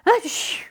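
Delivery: big high sneeze, loud; a child with a bad cold sneezing loudly. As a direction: burst out in a sudden childish achoo. Little sister sneezes from her cold.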